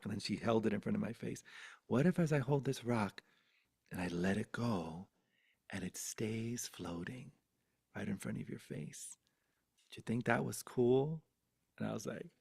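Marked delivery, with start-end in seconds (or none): 1.9-11.2 hushed, suspenseful coaxing; hypnotizing. Retold or imitated. Grandmother hypnotizing child with a floating rock, hushed. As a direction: softly draw a child into wonder. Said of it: "she started hypnotizing me with it".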